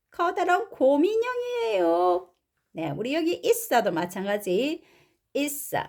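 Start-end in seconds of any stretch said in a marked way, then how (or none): 0.1-2.3 put-on high-pitched child voice; raised toddler character; story baby. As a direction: announce it like a little child. Baby's line in translation.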